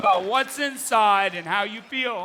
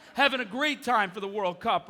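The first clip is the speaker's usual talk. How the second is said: ordinary talk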